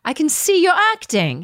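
a mock angry yell at the actress; retold yell. Director yelling at her during the scene, mock angry yell. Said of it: "He's yelling at me".

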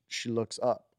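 ordinary talk

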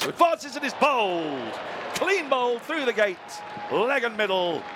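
rising excited wicket call. Real, not acted.

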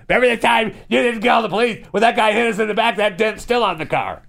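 high, fast mock nagging; put-on wifely harping in a high voice. Wife reminds husband of the old dent, mock nagging.

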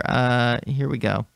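ordinary talk, nothing marked

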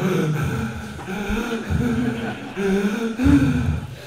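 sharp high gasp; startled gasp. Retold or imitated. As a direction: gasp in shock at the buck. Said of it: "you can hear him go".

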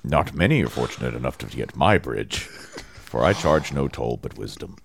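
a gentle storybook ogre guarding a bridge; kindly ogre voice. Acted. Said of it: "you sound like an, like a, a kindly ogre".

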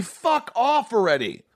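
high, drawn-out mock outburst; acted snapping. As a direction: play losing patience with a colleague, stretched and high. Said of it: "Did you ever snap at Ricky?".

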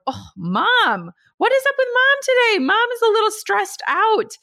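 high, sing-song teasing of herself; playful mom voice. Acted. Mom teasing herself to her toddler, high and playful. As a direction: pitch way up, light and playful for a toddler.

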